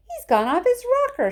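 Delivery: loud, high, horrified; a father horrified at Wonka, shouting; aghast shout. A narrator's reading. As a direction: yell it in alarm. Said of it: "shouted one of the fathers, aghast".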